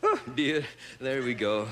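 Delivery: ordinary delivery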